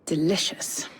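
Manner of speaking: breathy whispered relish; savouring whisper. Acted. Villain whispering his delight over a cup of tea. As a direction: whisper it like tasting something exquisite.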